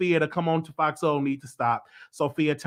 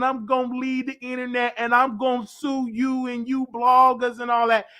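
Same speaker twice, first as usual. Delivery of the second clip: higher mock whine of gossip bloggers; 8 semitones higher than usual. Vlogger mocks bloggers' empty lawsuit threats, sneering. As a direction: parody their threats, sneering. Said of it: "y'all always talking".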